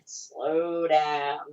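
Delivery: stretched-out "slow down"; drawn-out quoted reminder; director's sign. Retold or imitated. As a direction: read the sign out, drawing the words long. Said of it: "always holding up that sign".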